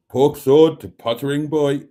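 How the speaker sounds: reading it out while breaking into laughter; can barely get the words out for laughing. Real, not acted. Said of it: "that really just made me laugh".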